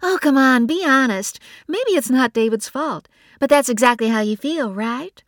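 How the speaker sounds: high, loud, fast needling; teasing Bree. A narrator's reading. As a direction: coax the truth out with a tease. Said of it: "and Bree laughed".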